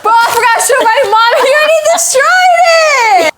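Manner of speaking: fast high yelling in dismay; frantic shout. Real, not acted. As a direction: yell in laughing despair. Brother destroying the room.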